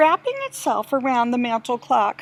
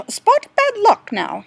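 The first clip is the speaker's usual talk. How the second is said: plain reading voice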